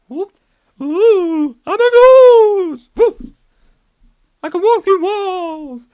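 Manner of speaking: high, loud wailing ghost; spooky ghost voice. Pretend. Newly dead ghost boasting, hammed-up wail. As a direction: ham up the moaning ghost.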